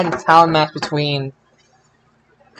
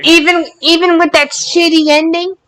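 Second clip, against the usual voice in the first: much higher, disbelieving rise; can't believe your pick; high disbelieving question; incredulous challenge; 11 semitones higher than usual. Real, not acted. Challenging co-host's match pick.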